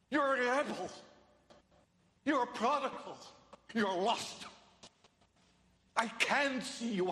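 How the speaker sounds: high, loud condemnation easing into falling pleas; forceful accusation. Acted. The law telling sinners they are lost, forceful. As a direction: press each charge home, then plead. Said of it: "That's what the law is telling us".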